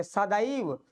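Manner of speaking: सदैव with a wide 'ai', sa-daa-iv; correct pronunciation. Shown as a demonstration. Teacher models double-matra word for students, careful pronunciation. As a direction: open the middle of सदैव into a-i. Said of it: "तो ऐसे बोलेंगे".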